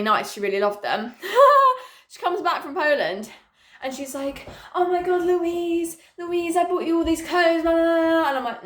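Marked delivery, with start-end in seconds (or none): 4.7-8.3 higher-pitched mock mum voice; put-on parent voice, pitched up; imitated gushing mum. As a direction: imitate mum calling out, drawing out the name. Acting out mum back from Poland.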